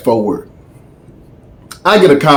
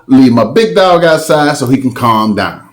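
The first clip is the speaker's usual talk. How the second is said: ordinary talk, nothing marked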